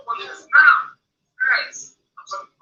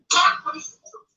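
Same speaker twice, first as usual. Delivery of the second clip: ordinary talk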